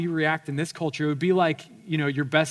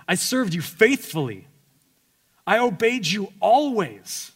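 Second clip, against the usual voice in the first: aggrieved elder son; 7 semitones higher, 6 dB louder than usual. Acted. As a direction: plead your loyalty, wounded. Elder son voiced, pleading to father.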